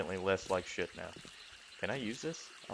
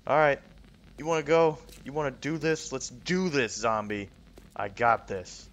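loud, higher taunting challenge; 4 semitones higher, 6 dB louder than usual. Real, not acted. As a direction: square up and yell at it. Challenging the zombie.

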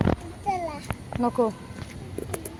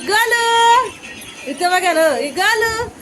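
excited calling; 7 semitones higher, slower than usual. Real, not acted. (बाळाला उत्साहाने हाक)

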